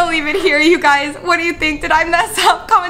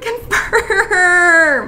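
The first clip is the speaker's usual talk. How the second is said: despairing laugh; 3 semitones higher than usual. Real, not acted. Laughing at her own despair.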